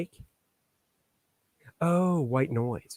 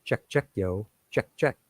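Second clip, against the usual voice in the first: mic check; 3 semitones lower than usual. Shown as a demonstration. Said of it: "That's how I do my mic check".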